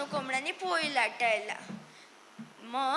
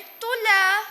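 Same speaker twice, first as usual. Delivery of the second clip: high little answer; small mouse voice; 6 semitones higher, 9 dB louder, much slower than usual. Acted. Mice answer sheepishly. (उंदराचा बारीक आवाज)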